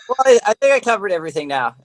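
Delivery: ordinary delivery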